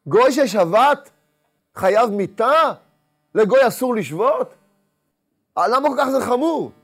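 high, loud, slow preacher's build; a rabbi preaching to his congregation, rising with fervour; impassioned preaching. Acted.

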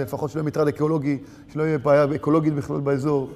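low, soft, giving-up voice; a man who's given up, muttered low and fast at times; quiet self-pity of a hopeless seeker; despairing. Retold or imitated. Despairing inner voice of failure.

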